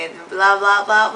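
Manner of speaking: ordinary delivery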